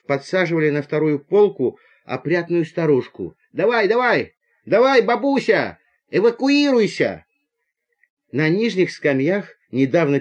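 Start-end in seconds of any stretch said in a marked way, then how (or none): 3.5-7.2 high, lively calls of encouragement; brisk urging. Acted. Young craftsmen hoisting an old lady onto the bunk, urging. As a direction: egg her on, pitched up high. Lads urging granny upward.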